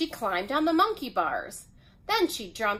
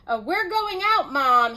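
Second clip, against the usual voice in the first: higher, louder call to Mom; 5 semitones higher, 6 dB louder than usual. A narrator's reading. Zach telling Mom they are leaving, calling out. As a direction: call out so it carries to another room. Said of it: "he called".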